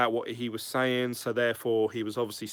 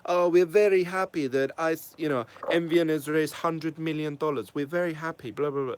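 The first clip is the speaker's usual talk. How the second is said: a deliberately bad, higher put-on accent mocking the CEO's video; 5 semitones higher than usual. Vlogger mocking the CEO's upbeat video in a bad accent. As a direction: ham up a clumsy foreign accent, pitched high. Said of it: "that was a really shit accent".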